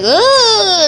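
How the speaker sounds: a squealed charge cry; high mock battle cry. Charging toy soldier lets out a shrill cry.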